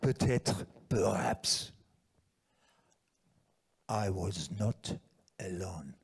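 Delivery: put-on slow, low, whispery French accent; weird French accent. Ending the friend's philosophical answer, in a weird French accent. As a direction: draw it out in a husky French accent, with long pauses. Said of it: "weird French accent".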